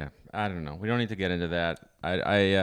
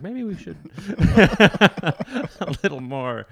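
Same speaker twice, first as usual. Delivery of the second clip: higher, louder, very slow and laughing; mock timid suggestion; 9 semitones higher, 9 dB louder, much slower than usual. Cohost mocks a missionary's son, laughing slowly. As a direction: play it as a hesitant preacher's kid, giggling. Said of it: "a missionary's son is like".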